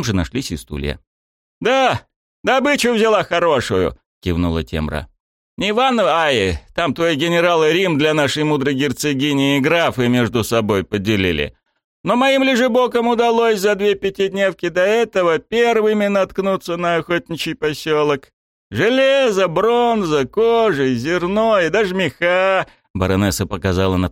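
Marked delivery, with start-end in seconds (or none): 1.6-4.0 loud, high boastful matron; booming baroness. A narrator's reading. 5.6-22.7 booming baroness. A narrator's reading. Baroness brags about plunder.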